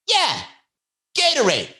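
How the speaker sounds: high-pitched imitated eager answer; mock excited order; eager street guy. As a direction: shoot the pitch way up, like a keen customer. Acting out the man's eager order.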